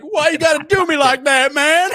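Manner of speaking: very loud, high mock wail; wailing crackhead. Crackhead protesting in jail, loud high wail. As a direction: cry out in hammed-up despair. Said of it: "he's like".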